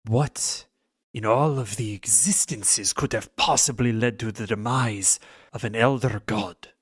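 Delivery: ordinary talk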